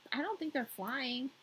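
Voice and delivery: ordinary delivery, nothing marked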